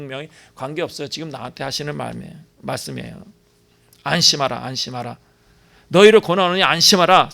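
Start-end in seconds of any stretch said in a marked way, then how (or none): 4.0-7.3 emphatic repeat. Acted. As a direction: repeat it louder, pressing it home. Preacher repeating comfort, rising emphasis.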